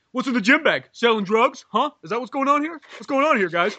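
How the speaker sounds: pushy cop questioning. Retold or imitated. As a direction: grill them like a suspicious cop. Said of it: "He's like".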